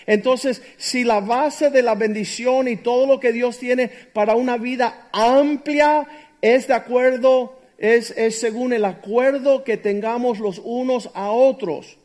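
loud, slow, emphatic preaching; fervent pulpit delivery; full-force preaching. Acted. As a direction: preach it out to the congregation.

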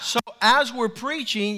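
usual speaking voice

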